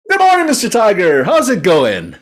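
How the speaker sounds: high, loud storybook frog; high-pitched, brazen little frog; chirpy frog character. A narrator's reading. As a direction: greet the tiger with forced cheer. Frog greets tiger to save himself.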